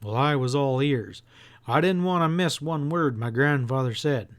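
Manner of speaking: plain reading voice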